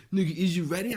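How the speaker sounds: mock repeated question. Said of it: "You was always asked that shit".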